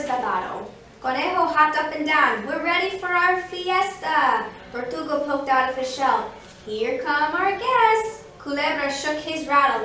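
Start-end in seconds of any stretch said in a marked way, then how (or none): 2.5-4.5 high, drawn-out excitement; eager rabbit. Acted. Rabbit hopping, eager for party. 6.7-8.2 high, loud, stretched-out call; excited announcement. Acted. Tortoise announces the guests arriving.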